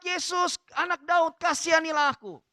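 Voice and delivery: preacher re-enacting a beggar's call; retold pleading shout. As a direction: cry out as the blind beggar, pitched high. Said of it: "Maka dia berteriak".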